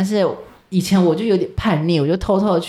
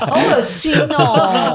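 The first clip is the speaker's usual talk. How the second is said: slow, higher, laughing through it; laughing disgust; 7 semitones higher, much slower than usual. Real, not acted. Laughing at the snot-on-pillow story, drawn-out disgust. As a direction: drag it out while laughing.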